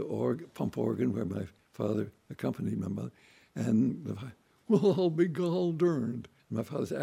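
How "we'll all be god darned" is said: astonished country farmer. Retold or imitated. Farmer amazed to hear they are musicians, slow high drawl.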